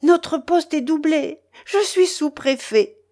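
high, loud, slowed-down jubilation; exultant, high-pitched proclamation; overjoyed exclamation. A narrator's reading. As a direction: announce your triumph, bursting with joy. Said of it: "qui ne se tenait pas de joie".

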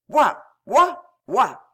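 three loud, high barks; dog barking. A narrator's reading. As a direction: bark "woof" like a dog. Barks punctuate the minister's speech.

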